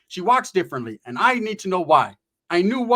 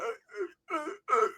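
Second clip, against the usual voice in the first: high strained grunting noises; mock high-pitched grunts; crackhead grunts; 9 semitones higher, 8 dB quieter, slower than usual. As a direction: imitate him straining in the bathroom. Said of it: "That's how he was in the bathroom".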